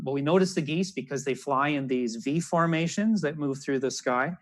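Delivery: ordinary talk, nothing marked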